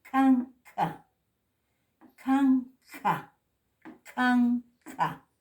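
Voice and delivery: CANCA with a strong puff of breath after each k; loud CANCA, k released with a rush of air; aspirated K. Shown as a demonstration.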